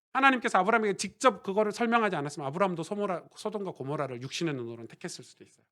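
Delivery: normal talking voice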